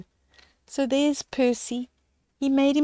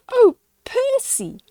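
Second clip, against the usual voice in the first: giggly high delight; 13 semitones higher, 4 dB louder than usual. Acted. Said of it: "the smallest penguin giggled".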